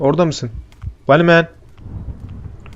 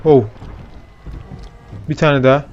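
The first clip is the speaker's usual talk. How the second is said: ordinary talk, nothing marked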